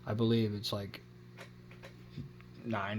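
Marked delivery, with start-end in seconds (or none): none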